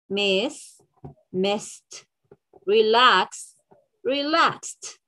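careful isolated words, -ed as crisp "t"; over-articulate endings as in "washed", "watched", "relaxed"; teacher modelling word pairs for repetition; final t sound drill. Shown as a demonstration. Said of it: "That was the first sound".

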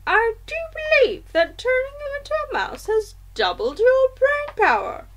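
high-pitched grandmother marvelling; amazed grandmother. A narrator's reading. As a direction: marvel in a high old-lady voice. Grandmother amazed by child's idea.

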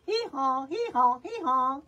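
three punchy donkey brays. Acted. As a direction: bray like a grumpy donkey. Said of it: "grumbled Donkey".

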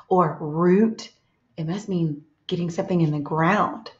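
ordinary delivery

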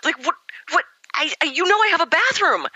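high, stammering retold shock; mock bewildered sputtering; flustered disbelief. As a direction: sputter like you've lost your words. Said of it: "Imagine my surprise".

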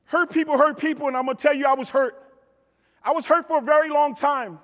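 usual speaking voice

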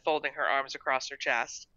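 plain reading voice

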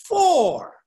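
a loud, high "For."; stressed word. Shown as a demonstration. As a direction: ring out "For." as the key word. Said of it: "That word "for".

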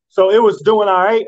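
ordinary talk, nothing marked

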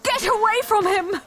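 high, frantic cry; desperate warning. Acted. Sister defending her brother, desperate high cry. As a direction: desperately drive the attacker off.

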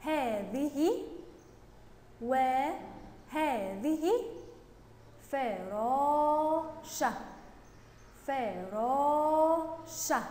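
very drawn-out syllables; slow read-along. Shown as a demonstration. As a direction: sound each word out slowly for the class. Teacher leads pupils reading together. (قراءة بطيئة بمدّ المقاطع)

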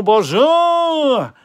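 bonjour sung out high, loud and long; hammed-up French bonjour. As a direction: stretch bonjour into a big mock French greeting. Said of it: "I cannot do French accent".

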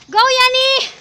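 high, loud call; rousing cheer; cheering someone on. Real, not acted. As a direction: shout encouragement to a companion ahead. Cheering on a companion.

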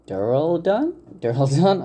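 "Duraldun" lifted high into a question; unsure pronunciation. Real, not acted. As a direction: sound out "Duraldun" twice, each time rising questioningly. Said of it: "I think that's how you pronounce that".

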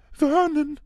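high, quavering frightened voice; trembling voice. A narrator's reading. Aunt frightened by the letter, trembling to her husband. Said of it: "sagte Tante Petunia mit zitternder Stimme".

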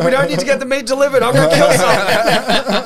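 high, loud joking boast, laughing through it; hammed-up villain line, laughing; silly mock threat. Teen worker joking to coworkers, high and laughing. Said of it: "I was like".

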